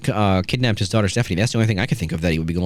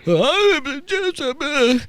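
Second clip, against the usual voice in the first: put-on a stretched-out, high, dazed old man's voice; lightly hammed Vince McMahon, drawn out high; mock Vince; much slower than usual. Podcast host mimicking Vince stammering to the cop, high and slow. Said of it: "And he's like".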